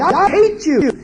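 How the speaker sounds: I hate you flung out high and loud. Retold or imitated. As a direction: shout hate at the parent. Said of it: "child said to you".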